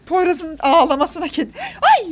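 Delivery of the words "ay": ordinary delivery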